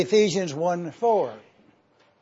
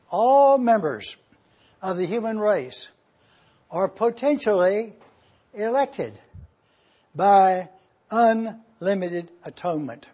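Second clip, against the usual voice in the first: usual speaking voice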